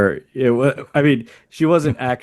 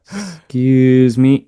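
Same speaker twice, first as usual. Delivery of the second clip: slow, louder "Excuse me" rising at the end; soft cute tone; 3 semitones higher, 6 dB louder, much slower than usual. Shown as a demonstration. Host demonstrating the tricky gentle tone to a learner. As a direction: coax the words out slow with a lift on "me". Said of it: "How to get that tone".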